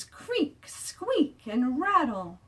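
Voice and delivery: a high squeak on squeak; squeaky swings. A narrator's reading. Reading to kids, squeaking like playground swings. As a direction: make the swings creak and squeak. Swing noises read aloud.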